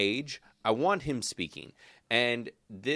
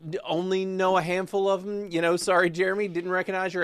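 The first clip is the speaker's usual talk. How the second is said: much higher, louder protest; mock defensive; 9 semitones higher, 6 dB louder than usual. Real, not acted. Host corrected on an actor's accent, mock defensive. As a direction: protest your ignorance high and loud.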